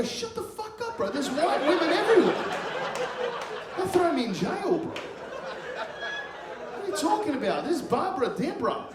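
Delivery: put-on high-pitched, frantic hushing of a child; strained high voice, afraid of being overheard; panicked dad. Dad shushes screaming son before onlookers, in high panic.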